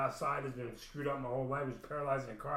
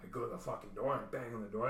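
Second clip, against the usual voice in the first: usual speaking voice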